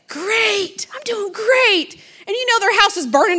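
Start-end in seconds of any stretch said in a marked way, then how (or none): 0.1-1.9 high, drawn-out put-on cheer; mock chirpy churchgoer; fake cheerfulness. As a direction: sing out an overbright, false reply.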